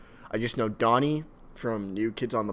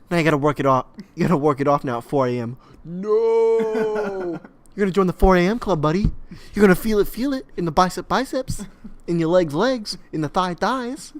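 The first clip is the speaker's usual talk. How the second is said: high, loud trainer-style celebrity impression; Mark Wahlberg; 6 semitones higher, 4 dB louder than usual. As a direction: drive them through a workout, voice raised. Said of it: "my Mark Wahlberg".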